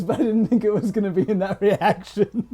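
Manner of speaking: talking through real laughter; amused, laugh breaking into the words. Real, not acted. Listener laughing at joke's ending.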